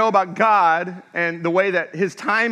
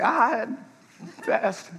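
sheepish drawn answer; 5 semitones higher, 5 dB quieter than usual. Retold or imitated. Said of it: "And I was like".

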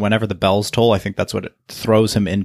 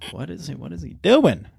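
incredulous rising question; half-playful what-is-he-doing; exasperated disbelief; 7 semitones higher than usual. Retold or imitated. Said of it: "makes me go".

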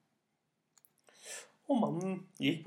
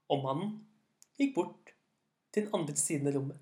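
usual speaking voice

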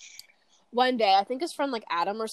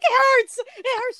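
very high-pitched fake crying in pain; mock bawling; 7 dB louder than usual. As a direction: re-enact a child bawling from pain. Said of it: "I was bawling my eyes out".

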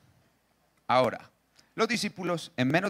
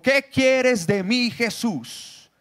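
high, loud and slow, a tormented howl; a demon-possessed man begging, shouted high and slow; possessed man's cry; 8 semitones higher, 5 dB louder, much slower than usual. Acted. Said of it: "gritando muy fuerte le dijo".

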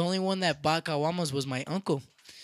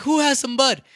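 loud, high call-out; retold shout; 8 semitones higher, 10 dB louder than usual. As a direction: holler the question to everybody. Calling out to a rowdy group.

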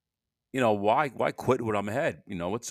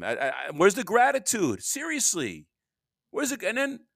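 indignant outburst; 10 semitones higher than usual. Real, not acted. Ranting to listeners about ungrateful motel neighbors, high indignant outburst. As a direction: voice jumping way higher in disbelief.

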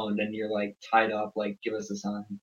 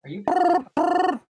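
squeaked much higher and louder; high squeaky voice; 5 dB louder than usual. Pretend. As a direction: squeal the question.

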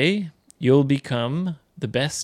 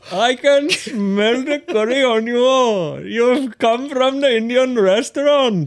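high-pitched, exaggerated Indian English; Indian accent; 11 semitones higher, 4 dB louder than usual. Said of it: "インド人のね". (高い声の大げさなインド訛り)

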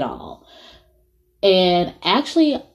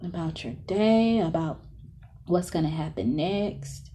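softer, half-whispered breathing cues; guided meditation; 9 semitones higher, 12 dB quieter than usual. Acted.

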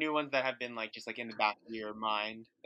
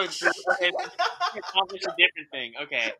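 normal talking voice

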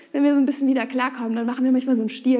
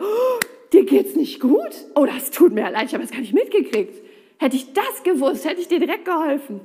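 mock clueless God; 4 dB louder than usual. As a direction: play a God who just noticed. Clueless God voiced apologetically.

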